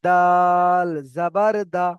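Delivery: long level "দাল", short "দা"; spelling demonstration. Shown as a demonstration. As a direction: stretch "দাল" slowly and firmly. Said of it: "এবারে".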